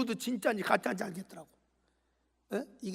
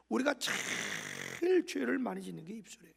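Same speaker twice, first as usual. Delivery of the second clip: ordinary delivery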